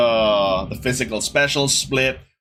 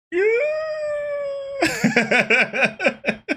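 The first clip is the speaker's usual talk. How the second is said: a high squealing laugh of disbelief; disbelieving high-pitched laugh; pained squeal laugh. Retold or imitated. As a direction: squeal it out like it hurts to watch. Exasperated "I was like" reaction.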